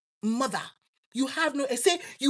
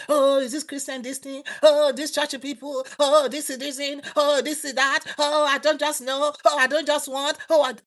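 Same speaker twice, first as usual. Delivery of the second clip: mock excuses; 2 semitones higher, 4 dB louder than usual. Mocking excuses of non-churchgoers.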